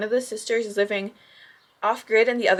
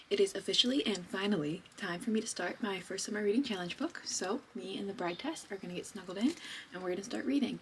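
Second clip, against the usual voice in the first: much softer, hushed and snug; soft cozy voice; 10 dB quieter than usual. Real, not acted. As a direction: settle in softly for a cozy read. Cozy rainy reading time.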